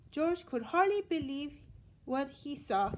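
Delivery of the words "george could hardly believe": usual speaking voice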